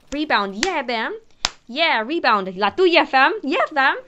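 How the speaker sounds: gloating victory chant; hyped comeback. Retold or imitated. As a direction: rub the comeback in her face. Mocking comeback to the girl.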